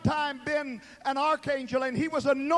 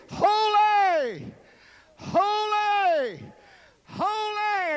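high, loud, drawn-out holy; exalted cry; 7 semitones higher, 6 dB louder, much slower than usual. Acted. Seraphim crying out before the throne, high and loud. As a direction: cry each holy out like an angel.

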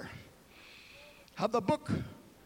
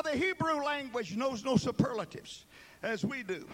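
ordinary talk, nothing marked

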